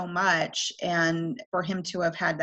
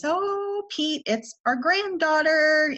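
high, lilting grandma greeting; sing-song voice; 9 semitones higher, 3 dB louder, slower than usual. Retold or imitated. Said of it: "do the sing-song voice".

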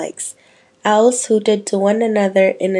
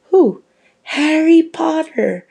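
put-on high, eager little girl voice; man doing a woman's voice; 9 semitones higher, 6 dB louder than usual. A narrator's reading. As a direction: squeak it like an excited young girl.

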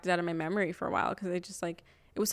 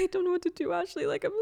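much higher, tearful helpless whine; imitating crying; 11 semitones higher, faster than usual. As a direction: play the crying friend asking for help. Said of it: "she's crying".

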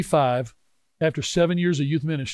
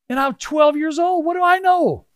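put-on high, slow, indignant cry of a twelve-year-old; exasperated boy; 11 semitones higher, 4 dB louder than usual. Preacher recalling being an unheard boy, exasperated and high. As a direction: throw your hands up at being ignored.

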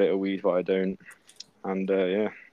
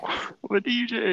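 mocking echo; 13 semitones higher, slower than usual. Mocking friend's brag.

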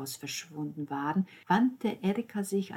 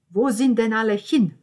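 loud, raised querying voice; bewildered grandmother; 5 semitones higher, 12 dB louder than usual. Acted. Grandmother sees the table emptying, loudly bewildered. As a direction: ask the whole room loudly.

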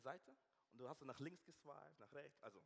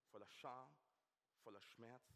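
ordinary talk

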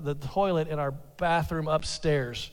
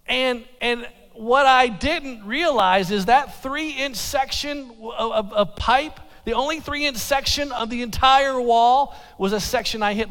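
ordinary talk, nothing marked

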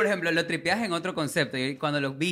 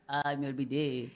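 mock excuse; 5 semitones lower, 9 dB quieter, slower than usual. Mocks the Dalai Lama excuse.

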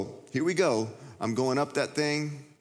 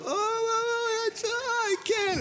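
put-on very high, whimpering kid voice; scared kid; much slower than usual. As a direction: whine like a terrified little boy up high. Scared kid frozen on high dive.

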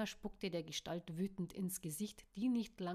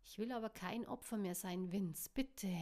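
usual speaking voice

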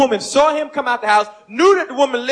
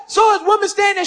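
usual speaking voice

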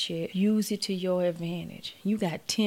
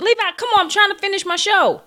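very loud, very high, frustrated shout; begging the dog to let her finish the show; yelling at the dog; 13 semitones higher, 13 dB louder than usual. Real, not acted. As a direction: holler at the dog.

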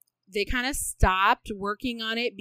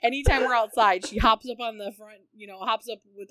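ordinary talk

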